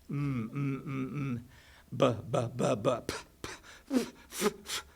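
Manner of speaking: repeated "mm", "b", "p" and "f" sounds, each pressed through the lips; labial sounds. Shown as a demonstration. Teacher demonstrating labial consonants to the class, lips pressed and popping. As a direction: buzz the lips through "mm", then pop "b" and "p", then hiss "f". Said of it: "We have some that are labials".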